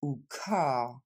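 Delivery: ordinary talk, nothing marked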